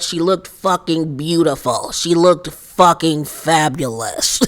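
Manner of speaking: a hint of slurred, drunken praise; imitating drunk Erica. Vlogger voicing drunk Erica slurring praise, lightly. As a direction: lightly slur it like a tipsy friend. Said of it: "she was like".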